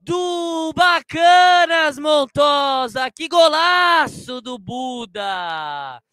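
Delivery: roaring, stretched-out goal call; explosive goal commentary. Real, not acted. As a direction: scream the goal, hold every vowel. Goal scored, commentator erupts.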